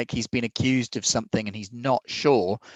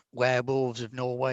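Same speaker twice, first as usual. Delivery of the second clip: ordinary talk, nothing marked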